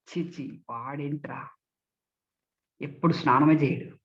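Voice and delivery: low, repelled disdain; disgusted. Shown as a demonstration. Speech trainer complains about someone who never bathes, disgusted. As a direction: recoil in distaste, voice dropped low. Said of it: "ఏవగింపు".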